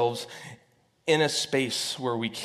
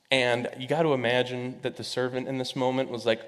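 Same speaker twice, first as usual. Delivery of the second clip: ordinary talk, nothing marked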